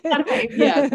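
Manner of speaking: high laughing echo. Real, not acted. Member laughing back at the coach's joke. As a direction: laugh as you repeat her words. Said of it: "laughs back at coach".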